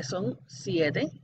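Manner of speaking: usual speaking voice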